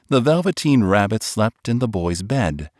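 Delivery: ordinary delivery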